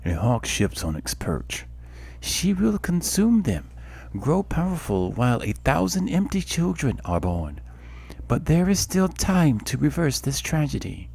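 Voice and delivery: a goddess warning of tragedy; solemn goddess voice. Acted. Helia speaks through the birds.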